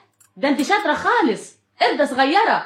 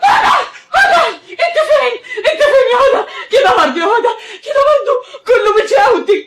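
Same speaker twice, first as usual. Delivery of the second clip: high, tearful shouting; sobbing frantic mother; 7 semitones higher than usual. Acted. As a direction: wail her name between sobs. Mother after daughter falls.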